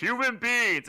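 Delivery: loud, grand proclamation; booming voice of God. Retold or imitated. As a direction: thunder it out like God. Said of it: "instead of being like".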